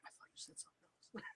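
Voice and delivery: fast, breathless whisper; whispered mumble. Retold or imitated. Clueless alien mumbling an excuse, whispered fast. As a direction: throw it away under the breath. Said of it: "the other guy's just like".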